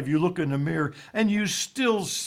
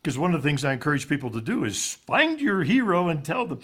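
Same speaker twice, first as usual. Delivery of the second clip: ordinary talk, nothing marked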